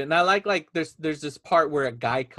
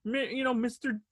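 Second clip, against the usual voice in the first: Muppet-like voice; 7 semitones higher, 6 dB quieter than usual. Retold or imitated. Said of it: "he sounds like a Muppet".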